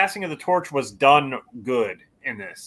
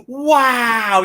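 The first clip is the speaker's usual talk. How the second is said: drawn-out mock-amazed wow; higher-pitched, gliding slowly downward in mock awe; awestruck kid imitation; 6 semitones higher than usual. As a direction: stretch out a kid's amazed "wow". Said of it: "and he's like".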